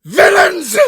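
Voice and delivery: a very high, very loud shriek; shrieking at the mocking police. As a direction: scream it at the officers. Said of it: "I shrieked".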